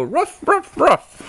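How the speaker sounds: very high yappy little dog; little dog barking. A narrator's reading. Little dogs go ruff, very high puppy barks. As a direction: bark like a tiny puppy. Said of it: "and little dogs go".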